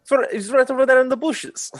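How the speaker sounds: performer voice. Acted. Performer character pleading to guard.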